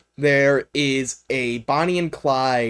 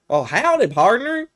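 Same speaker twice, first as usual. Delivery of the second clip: high-pitched, silly cowboy robot greeting; 9 semitones higher than usual. Retold or imitated. Cowboy robot welcoming someone to Good Springs, silly high voice. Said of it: "very silly voice".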